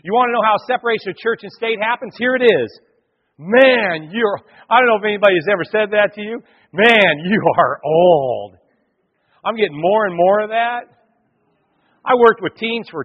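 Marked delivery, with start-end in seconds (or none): 3.4-4.4 loud mock-elder jab; blunt accusation. Elders confronting Samuel, loud blunt accusation. 6.7-8.6 loud, drawn-out proclamation; blunt accusation. Acted. Elders confronting Samuel about his age, loud blunt accusation.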